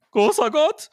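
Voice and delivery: high, loud cry of dismay; groaned exclamation. A narrator's reading. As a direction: exclaim in horror as Louis. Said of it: "ächzte Louis".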